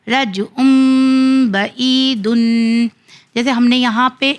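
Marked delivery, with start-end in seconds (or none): 0.1-2.9 "رجعن" with n turned to a long hummed m; iqlab recitation. Shown as a demonstration. Teacher applying iqlab for students, long held nasal. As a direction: recite "رجعن بعیدن" very slowly, holding the nasals. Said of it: "یہاں پہ بھی اقلاب کا قانون کیا".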